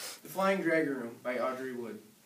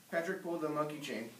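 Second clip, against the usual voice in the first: ordinary delivery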